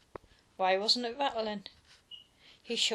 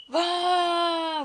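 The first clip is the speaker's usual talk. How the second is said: higher, louder crying wah; babyish wail; 8 semitones higher, 8 dB louder than usual. A narrator's reading. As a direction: wail like a hurt little brother. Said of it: "wailed Peter".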